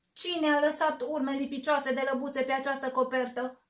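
loud, grumbling bear complaint. Acted. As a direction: growl out the accusation. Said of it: "bombănie el".

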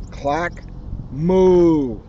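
ordinary delivery, nothing marked

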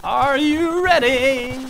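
high, loud, slow showman call; hype announcer. Acted. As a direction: drag it out like a ring announcer. Hypes up a ship crash.

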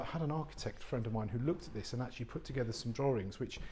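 ordinary talk

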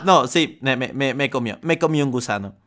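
dejected after getting eaten; deflated. Real, not acted. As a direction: mutter it after losing. Just got eaten, deflated.

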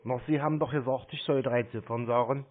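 ordinary talk, nothing marked

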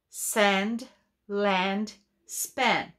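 "Sand, land, span" with a long open a, each word alone; teacher modeling words. Shown as a demonstration. Teacher models sand land span for pupils. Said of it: "teacher repeats rhyming words".

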